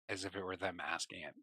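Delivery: ordinary talk, nothing marked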